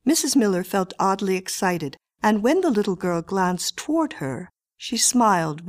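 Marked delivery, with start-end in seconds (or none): none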